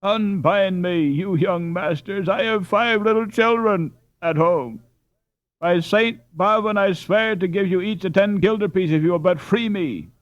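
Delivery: piteous cry. A narrator's reading. Robber begging the young masters, piteous cry. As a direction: beg with piteous despair. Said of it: "cried in a piteous cry".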